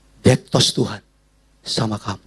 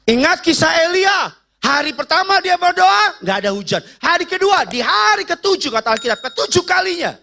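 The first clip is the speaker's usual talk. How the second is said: full-force preaching; 12 semitones higher than usual. Acted.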